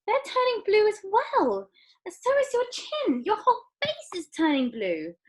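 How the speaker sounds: very high, rising alarm; panicked mother. A narrator's reading. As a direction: panic spreading. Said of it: "screamed Missus Beauregard".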